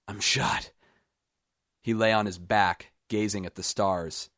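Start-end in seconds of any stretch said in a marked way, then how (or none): 0.1-0.7 strained gasp of a wounded man. A narrator's reading. Shot man tells Roop he is hit, gasping. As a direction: gasp it out in shock. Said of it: "he gasped".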